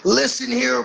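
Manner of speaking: half-laughing callout to a rival; wrestling promo. Pretend. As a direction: start the callout with a grin. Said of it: "I gotta cut a promo on Briggs".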